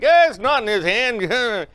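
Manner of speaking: high, loud mocking voice; teasing family member. Family teasing the kid after the trick, high loud mock.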